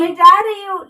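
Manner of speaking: loud, hurt outburst; upset shout. Acted. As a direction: yell it back as you go. Daughter shouts while leaving.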